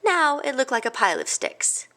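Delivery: ordinary delivery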